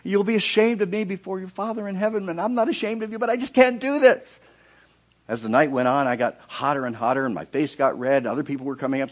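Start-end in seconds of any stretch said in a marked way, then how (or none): 0.0-4.4 high, loud, desperate plea to the Lord; pleading prayer. Retold or imitated. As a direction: cry out, begging not to be judged. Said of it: "And I was praying".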